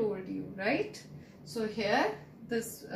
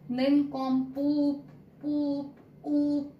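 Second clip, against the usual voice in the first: Nincompoop said slow and loud, oo held long; teacher exaggerating the oo for the class; long stressed oo; 5 semitones higher, 5 dB louder, slower than usual. Shown as a demonstration. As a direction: stretch every oo in Nincompoop, poop, oop. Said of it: "we spoke the oo sound with lot of stress".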